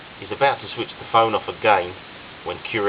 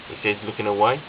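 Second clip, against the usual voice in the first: ordinary delivery, nothing marked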